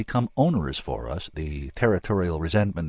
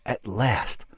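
ordinary delivery, nothing marked

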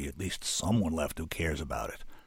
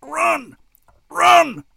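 much higher, louder panicked shout; shouting run; 17 dB louder than usual. A narrator's reading. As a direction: yell at the boy to flee. Fleeing danger, urging boy.

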